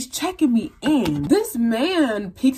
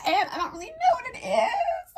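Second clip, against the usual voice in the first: much higher, strained, laughing-crying nerves; nervous squeak. Retold or imitated. Telling her teacher about the bump, mortified high squeak.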